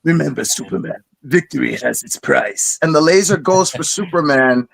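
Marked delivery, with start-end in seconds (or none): 0.0-2.8 low, quiet menacing villain, lightly voiced; a hint of the cold Darkseid threat; imitating a cartoon villain's deep warning. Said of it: "best Darkseid voice ever".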